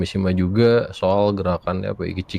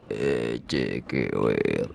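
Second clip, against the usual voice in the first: deep, very slow and soft; low, wasted-sounding tone; 10 semitones lower, 8 dB quieter, much slower than usual. Pretend. Checking the call is now correct, low woozy drawl. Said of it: "lebih ke teler gitu".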